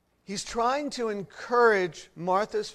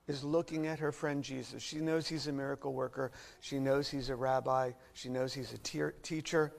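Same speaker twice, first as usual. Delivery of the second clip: ordinary talk, nothing marked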